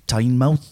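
Tynemouth drawn towards "Tayn-mouth", low; locals' pronunciation. Retold or imitated. Host gives the locals' name for the town, mock local pronunciation. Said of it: "as the locals refer to it".

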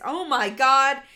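loud shocked exclamation. Real, not acted. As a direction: blurt it out, stunned. Shocked at picture.

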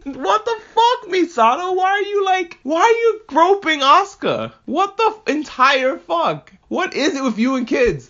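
high, slow, incredulous outburst; can't believe what you're seeing; shocked at Misato groping Asuka; outraged disbelief. Real, not acted. Outraged reaction to groping scene.

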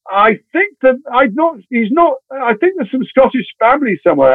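high, halting improvisation; hesitant bluffing. Retold or imitated. Agent bluffing to casting director about Scottish roots, high and hesitant. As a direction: stall in a high voice while making it up.

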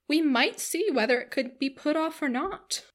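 lilting busybody character voice; prying matron. A narrator's reading. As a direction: coax like a nosy older lady. Voices prying Mrs Jennings.

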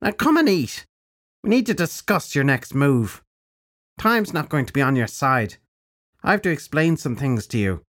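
put-on high, bright-edged old witch; old crone voice. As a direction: speak as a brisk old crone. Crone character voice.

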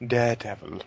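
"Daredevil" said in a put-on proper accent, clipped to "Dedevun"; said all proper. Shown as a demonstration. Co-host teasing the other about pronunciation, mock-proper "Daredevil". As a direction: show how to say "Daredevil" properly, put-on and affected. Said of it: "you gotta say it all proper".

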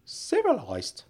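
CIVILISED pitched high then falling, in disbelief; incredulous echo. Acted. Uncle queries Frank's word, "Civilised" high and incredulous. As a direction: throw "Civilised" back in a high, doubting voice.

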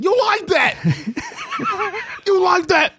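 high-pitched mock shout, breaking into laughter; very high, hammed-up holler; mock shouted catchphrase. Mimics quarterback's catchphrase while teasing.

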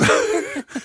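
a bright burst of laughter; laughing after the thanks; high giddy laugh. Real, not acted.

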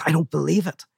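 a gambler who can't believe he won; stunned disbelief. Acted. Seamus stunned by his win.

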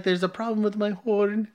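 high-pitched mock Italian accent. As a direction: attempt a comic Italian accent. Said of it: "Do it in the accent. He was, like, Italian".

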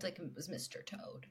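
hushed aside whisper. Real, not acted. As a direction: drop to a whisper. Aside about the ride's mascot.

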